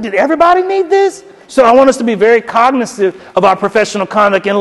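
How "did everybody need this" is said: very high-pitched, baffled question; incredulous. Retold or imitated. Reader baffled by a crowded CC list, incredulous. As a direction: squeak it out in disbelief. Puzzled reaction to crowded email copy.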